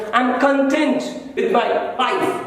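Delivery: "content" stressed on the second syllable, con-TENT, said slowly; satisfied sense; adjective content. Shown as a demonstration. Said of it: "उसका pronunciation बदल रहा है".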